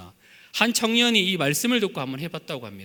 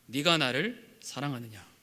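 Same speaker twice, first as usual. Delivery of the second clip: tender question; 4 semitones lower, 7 dB quieter than usual. Acted. Lord's question voiced gently.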